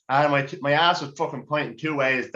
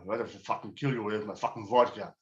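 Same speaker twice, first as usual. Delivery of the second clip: low, mumbled mock Russian accent; a lightly done angry Russian; quiet hammed-up Russian growl; Russian lad; 3 semitones lower, 7 dB quieter than usual. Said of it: "some fucking Russian lad going crazy".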